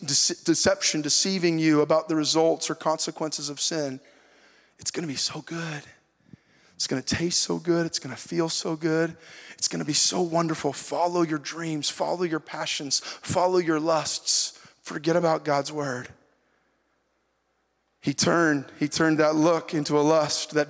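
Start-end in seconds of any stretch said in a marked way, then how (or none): 4.8-16.1 softer, coaxing tempter voice luring the listener; tempter's soft lure. Acted. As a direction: purr the promises like the serpent. Voicing the tempter's promises.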